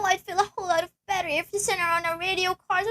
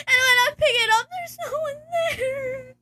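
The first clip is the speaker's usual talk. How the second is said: frightened sob; 6 semitones higher than usual. Acted. Tells of silent calls.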